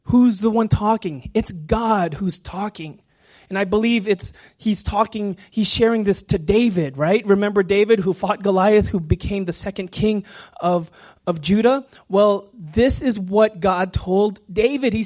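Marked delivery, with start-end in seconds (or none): none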